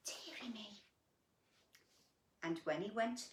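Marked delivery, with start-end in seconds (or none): 0.1-0.7 soft whispered gasp of mock dismay; hushed storyteller gasp to the child; whispered dearie me. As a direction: breathe it as a hushed aside. Aside after a gasp.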